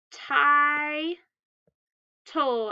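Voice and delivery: "tie" held long, then "tol" after a pause; split into syllables. Shown as a demonstration. Teacher splitting after the I, syllables held apart. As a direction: break the word into "tie" and "tol". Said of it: "we split after the I".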